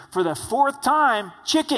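loud, high, slow snap at her husband; angry shout. Acted. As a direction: bark it out, fed up after asking four times. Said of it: "turns around in anger and says".

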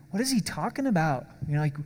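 imitating a puzzled listener; mock bafflement. Puzzled listeners questioning Jesus's illustration, mock bafflement. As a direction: act mildly lost.

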